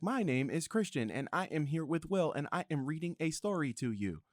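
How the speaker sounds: high, evenly measured robot narrator; Siri. Retold or imitated. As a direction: imitate an automated assistant reading word by word. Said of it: "this thing is like Siri reading to you".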